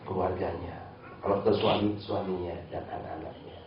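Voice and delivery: low, soft murmured prayer; quiet prayer. Acted. Praying to Allah for the friend who gossiped, low and soft. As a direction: pray quietly and humbly for a friend.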